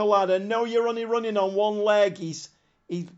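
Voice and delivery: ordinary talk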